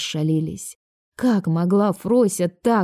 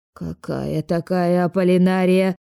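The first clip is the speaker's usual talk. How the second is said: put-on slow, severe old neighbour; stern; slower than usual. A narrator's reading. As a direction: interrogate sternly, drawing it out. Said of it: "строго уточнила та". (строго)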